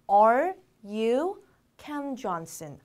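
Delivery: very slow, each word held apart; word-by-word model. Shown as a demonstration. Teacher showing learners how to ask someone's name, word by word. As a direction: spell out the question word by word. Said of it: "어떻게 물어볼까요?".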